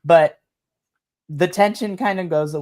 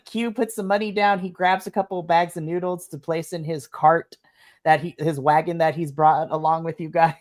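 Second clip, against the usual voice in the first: ordinary delivery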